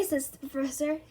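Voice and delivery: ordinary delivery, nothing marked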